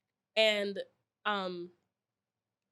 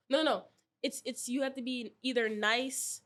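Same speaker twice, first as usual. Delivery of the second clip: ordinary talk, nothing marked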